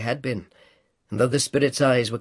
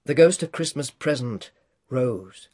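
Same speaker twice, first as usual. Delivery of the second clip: ordinary delivery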